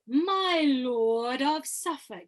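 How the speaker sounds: very slow, drawn-out, connected line; words joined up. Shown as a demonstration. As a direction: sweep slowly from word to word without a break. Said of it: "Now can you join those words up?".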